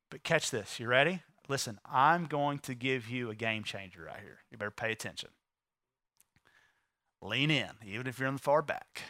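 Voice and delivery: low, soft aside to the crowd; confiding. Real, not acted. Preacher telling even the back rows to lean in, confiding. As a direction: drop low and soft to draw them in.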